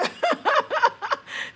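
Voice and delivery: loud high burst of laughter; real laughter bursting out; big laugh. Real, not acted. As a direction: crack up at herself. Laughs at own story.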